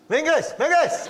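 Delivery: high-pitched call across a park; mock shouting. Uncle calling his dog in the park, mock shouting. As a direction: imitate someone calling out for a dog. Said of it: "he'd just be shouting".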